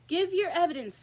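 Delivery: sharp, loud royal demand; angry king. A narrator's reading. King angrily demands the Hatter's evidence. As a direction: bark the order at the witness. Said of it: "the king repeated angrily".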